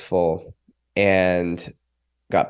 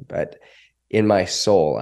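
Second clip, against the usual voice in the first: normal talking voice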